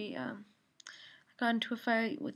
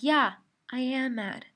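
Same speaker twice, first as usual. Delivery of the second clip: slow, stressed retort, a bit louder; retold defiant retort; 5 dB louder, slower than usual. Daughter answering her mom back in an argument, defiant. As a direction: draw out a defiant admission.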